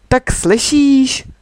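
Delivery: a mild mock call down the slope, drawn out. A narrator's reading. Niky calling after the girl on her skis, a mild slow call. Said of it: "křikl na mě ještě".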